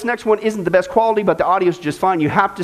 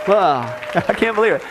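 ordinary delivery

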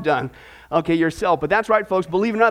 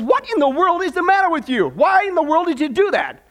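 shocked; 5 semitones higher than usual. Retold or imitated. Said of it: "he says".

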